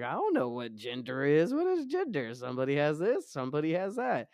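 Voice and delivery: put-on high, puzzled little kid voice; childlike confusion. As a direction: play your confused younger self. Childhood self quoted, confused.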